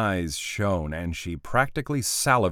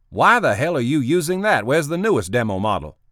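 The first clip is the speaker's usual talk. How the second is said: loud, high exasperated demand; angry boss; 7 semitones higher, 6 dB louder than usual. Acted. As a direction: bark out the question. Boss angry at wrong tablet.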